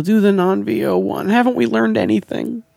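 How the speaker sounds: high, pleading exasperation; acted-out exasperated fan; retold dismay. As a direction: plead in mock despair. Retold dismay at hearing voiceover.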